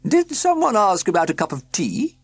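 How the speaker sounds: high, loud cheery woman's voice; a bright chatty countrywoman; woman in overalls. A narrator's reading. Woman at the tent announcing the car problem, high and loud. Same woman offers tea.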